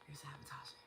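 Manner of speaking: hushed whisper to yourself; whispered realization. Retold or imitated. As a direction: whisper it like a private discovery. Voices her own sudden realization.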